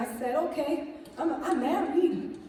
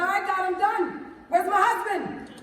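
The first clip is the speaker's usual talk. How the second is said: demanding God; 5 semitones higher, 5 dB louder than usual. Retold or imitated. She demands husband from God.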